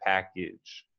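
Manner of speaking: "package" with the second vowel reduced to a schwa, pak-ij. Shown as a demonstration. Teacher modelling the schwa for her student in "package". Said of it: "sounds more like a, an I, like a schwa".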